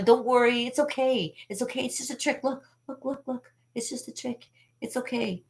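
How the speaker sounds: high, soft mock reassurance; imitated soothing. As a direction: hush a crying child in a high, soft voice. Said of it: "he had to calm me down".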